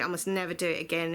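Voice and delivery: normal talking voice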